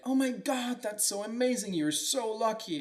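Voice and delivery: high, gushing mock neighbors; imitating thrilled neighbors; excited neighbors. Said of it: "all the neighbors are like".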